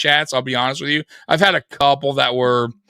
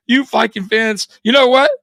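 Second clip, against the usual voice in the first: angry rant; 6 semitones higher than usual. Retold or imitated. Streamer ranting at rival fans, angry raised voice. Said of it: "I'm like".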